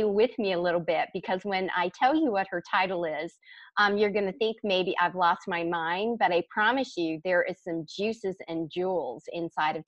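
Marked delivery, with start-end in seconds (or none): none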